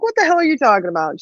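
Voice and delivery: quick, high imitation of a baffled dad; dad bewildered. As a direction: fire it off fast, mock exasperated. Clueless dad demands explanation.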